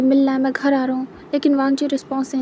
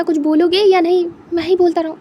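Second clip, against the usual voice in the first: louder, exasperated demand; frustrated outburst; 4 semitones higher, 6 dB louder than usual. Acted. Wing exasperated by Wang ji's silence on the phone, loud. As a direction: push him to answer, fed up.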